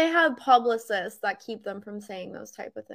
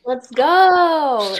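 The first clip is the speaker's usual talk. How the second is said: loud hype call; hype shout; 3 semitones higher, 10 dB louder than usual. Acted. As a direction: shout it like a fan. Hyping the chat call.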